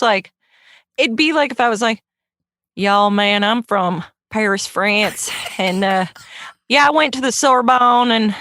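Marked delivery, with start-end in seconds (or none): 2.8-8.3 Southern farmer. Acted. Host imitating Mike pretending to be French, Southern farmer accent. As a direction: play a Southern farmer claiming to be Parisian. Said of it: "still doing his Southern farmer".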